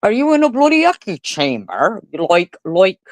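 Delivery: high, mock Aussie twang; hammed-up Australian mimicry; bad Australian accent. Mocking Perth locals with an Australian accent. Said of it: "I can't do an Australian accent".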